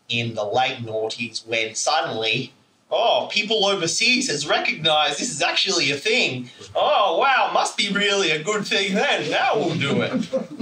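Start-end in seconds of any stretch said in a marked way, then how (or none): 2.9-10.6 mocking the locals. Mocking Aussies who follow overseas trends.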